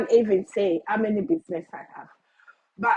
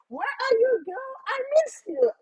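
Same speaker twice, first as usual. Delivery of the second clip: much higher playful voice of a fond coach; coach's affectionate message, voiced much higher; imitating Coach Georgia; 12 semitones higher than usual. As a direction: call out fondly like a coach missing her student. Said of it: "Coach Georgia always send me a message".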